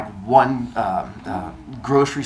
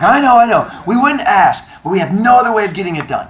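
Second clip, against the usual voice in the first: high, loud whiny pleading; pleading friends; 8 semitones higher, 8 dB louder than usual. Retold or imitated. Dorm friends begging for his car, high and whiny. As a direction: beg him high and loud, fast at the end.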